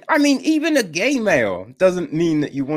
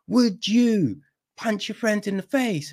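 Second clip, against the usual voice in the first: teacher to six-year-olds; 6 semitones higher than usual. Acted. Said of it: "when you've got little fucking six-year-olds".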